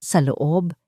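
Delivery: ordinary talk, nothing marked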